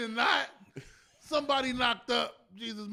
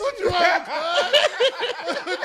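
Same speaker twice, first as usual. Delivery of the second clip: louder, higher challenge; confronting challenge; 5 semitones higher, 5 dB louder, much slower than usual. Acted. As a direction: call Peter out, laughing. Disciple confronts Peter.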